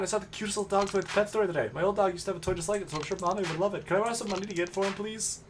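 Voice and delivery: put-on high wheedling kid voice, fast; begging child. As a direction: coax her into lending money. Child begs for money.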